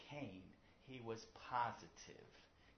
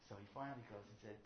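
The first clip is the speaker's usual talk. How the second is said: ordinary talk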